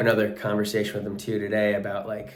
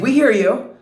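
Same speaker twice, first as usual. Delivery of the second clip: high, loud pushback on the gym guy; retold firm reply; 14 semitones higher, 9 dB louder than usual. [name] defending her friend to the yelling guy, high loud reply. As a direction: answer back sharply, mock-firm.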